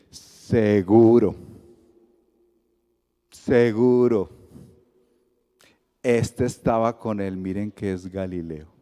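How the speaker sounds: slow, drawn-out seguro, seguro; knowing accusation. Retold or imitated. A man in the crowd calls Pedro out, slow and sure. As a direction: stretch it out like you caught him. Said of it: "Y le dice".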